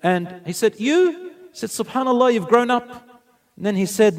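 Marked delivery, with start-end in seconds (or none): 0.8-1.3 high, louder questioning exclamation; retold surprise, voice shooting up high; astonished. Astonished at the grown girl. 1.7-2.9 higher, louder marvelling; acted-out wonder, pitched well up; amazed. Marvels that the girl has grown.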